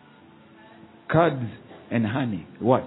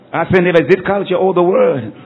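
pastor repeats the call much louder; 3 semitones higher, 7 dB louder than usual. Shown as a demonstration. Pastor urging the congregation again, now loud. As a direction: push it out to the whole hall.

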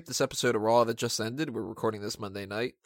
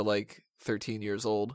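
usual speaking voice

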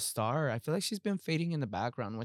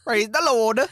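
put-on Scottish accent; 8 semitones higher, 10 dB louder, slower than usual. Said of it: "your Scottish accent".

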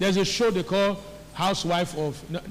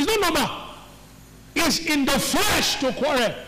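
raised, higher preaching shout; emphatic correction; 6 semitones higher than usual. Real, not acted. Preacher correcting the congregation on quarreling, emphatic shout. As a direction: correct them forcefully, pitch pushed up.

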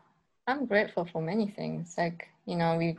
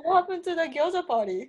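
laughing; 9 semitones higher, much faster than usual. Real, not acted. Teasing about the cancelled party.

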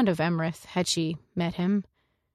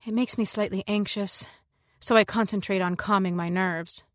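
plain reading voice

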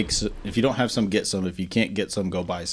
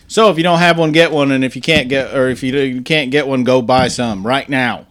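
loud, higher pitched pitch to listeners; loud announcement; 3 semitones higher, 6 dB louder than usual. Real, not acted. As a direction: announce it loudly to the audience. Announcing Red Beer Sunday.

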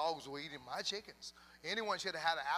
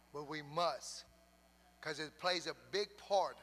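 usual speaking voice

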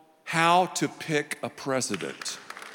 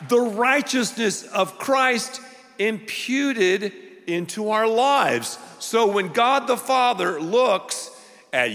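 higher, emphatic preacher's delivery; impassioned preaching; 7 semitones higher, slower than usual. Real, not acted. Preacher assures the redeemed they are righteous, impassioned emphatic preaching. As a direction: build phrase on phrase, holding the key words.

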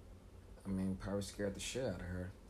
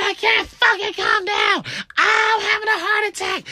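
hysterical high screaming; panicking; 17 dB louder than usual. Acted. Kid answering mom's order, panicked screaming. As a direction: scream it as if truly losing control. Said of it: "I'm panicking".

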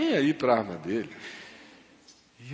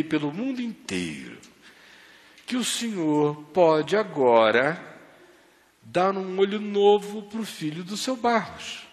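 earnest retold prayer, higher; praying; 4 semitones higher than usual. Father prays over the kneeling Barros, earnest. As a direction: plead with God for the boy's eye.

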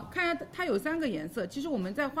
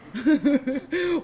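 loud laugh; 5 semitones higher, 9 dB louder than usual. Real, not acted. Giggling while doing makeup demo.

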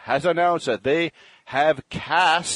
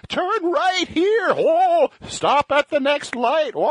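high, slow, panicky robot butler; C-3PO voice; 13 semitones higher, slower than usual. Retold or imitated. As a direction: fret like a nervous droid. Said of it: "it feels like you're friggin', like, having a stress attack".

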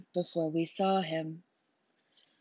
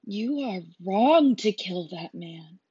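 slow and louder, pressing on wrong; firm rebuke; 6 dB louder than usual. Acted. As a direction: accuse him steadily, leaning on every word. Joshua confronts the killer.

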